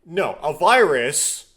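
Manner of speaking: loud, slow, high-pitched objection; exasperated correction. Real, not acted. Streamer corrects the game's science.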